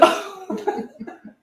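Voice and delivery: a real laugh breaking out high; high bursting laugh. Real, not acted. Woman laughing at her own bookkeeping trouble, bursting laugh.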